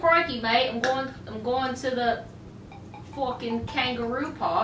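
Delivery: mock-Australian accent. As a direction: play a caricature Australian, groping for the line. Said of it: "It's easy to sound Australian".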